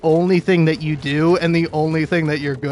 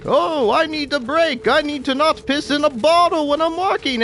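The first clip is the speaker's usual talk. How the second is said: higher, put-on pleading for a break; mock complaining worker; 10 semitones higher than usual. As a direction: imitate a worker begging the boss. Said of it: "You come up to me and you say".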